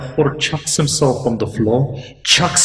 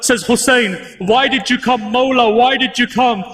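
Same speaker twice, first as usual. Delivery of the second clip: much higher and louder, building to an anguished wail; rising majlis lament; 11 semitones higher, 3 dB louder than usual. Acted. Preacher bringing mourners to tears over Hur's son, anguished climbing lament. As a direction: pour out the grief of the old father, building to the peak.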